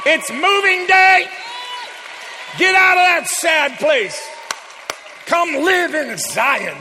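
loud, high, shouted sermon call; full-force preaching. Acted. Preacher shouts to the congregation, urging them to come to Zion. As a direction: rouse the congregation to move to Zion.

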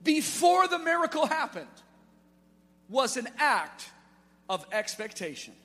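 loud, high impassioned preaching; rally the church to step out; preaching at full force. Real, not acted. Pastor urging the church to give, loud preaching.

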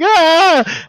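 high, loud exasperated cry; frustrated scream. Retold or imitated. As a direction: let out a fed-up scream. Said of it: "freaking out".

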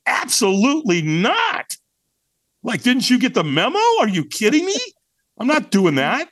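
high, indignant retold refusal; strong, pissed-off tone. Firewalk balker retelling his refusal to a stranger, pissed off. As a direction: push back hard, drawing out the first refusal. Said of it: "really strong tonality, almost like I was pissed".

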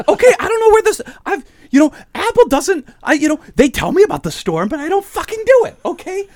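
high, loud mock rant of the confused lady; ranting psychologist. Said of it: "And she's going".

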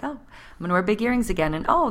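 ordinary talk, nothing marked

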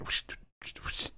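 quiet imitated whooshing; soft whoosh sounds. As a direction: mimic a faint machine whoosh. Imitating the washer noise.

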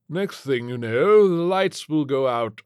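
slow, high, loud grumble; pompous billionaire. A narrator's reading. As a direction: predict disaster with pompous annoyance. Pompous billionaire character complains in story.

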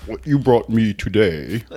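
slow Star Wars junk dealer voice; slow, put-on movie character; Unkar Plutt. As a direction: drag it out like an alien trader. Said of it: "It's a little Unkar plot".

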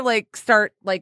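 ordinary talk, nothing marked

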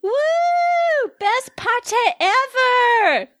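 high, long-drawn party whoop; partygoer shouting. Acted. Kenzie cheers at a party, high drawn-out whoop. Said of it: "massive wave of noise and shouting".

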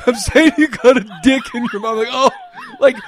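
giddy retelling; laughing. Real, not acted. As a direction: laugh through the quote.

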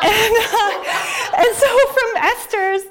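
laughing through the words, high; can't hold back a laugh while telling it. Real, not acted. Laughing at her own memory.

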